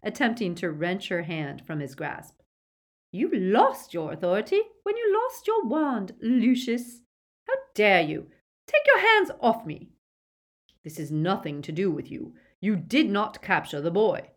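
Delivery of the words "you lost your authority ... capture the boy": high, loud, scornful woman; imperious outrage; furious Bellatrix. A narrator's reading. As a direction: berate him, flaring on the orders.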